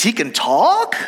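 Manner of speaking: high, incredulous rising exclamation; astonished soldier. Acted. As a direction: act out disbelief, lifting the last word. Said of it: "the soldier says".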